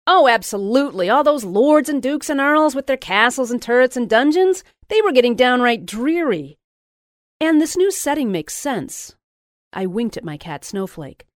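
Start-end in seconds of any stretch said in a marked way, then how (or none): 0.1-9.2 higher, louder, breathless gushing; gushing excitement. A narrator's reading. Friend gushing over the novel on the phone, high and loud. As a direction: pile on the enthusiasm.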